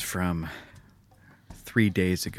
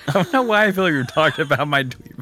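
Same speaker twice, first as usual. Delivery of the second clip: high, fast, loud laughing talk; words tumbling out amid laughs; 8 semitones higher, 7 dB louder, faster than usual. Real, not acted. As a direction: talk through a fit of laughter. Cracking up over his tweet.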